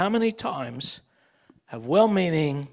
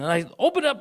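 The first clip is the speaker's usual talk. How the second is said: ordinary talk, nothing marked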